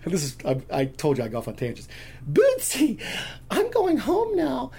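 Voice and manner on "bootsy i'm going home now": put-on very high, drawn-out child voice; cute little girl. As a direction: imitate a four-year-old in falsetto. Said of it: "this cute little blonde girl".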